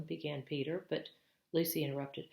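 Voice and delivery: ordinary delivery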